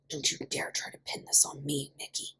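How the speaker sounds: soft, fierce whispered warning; furious whisper. A narrator's reading. Ian furiously whispering at Mickey. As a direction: spit it through a furious whisper. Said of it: "Ian whispered furiously".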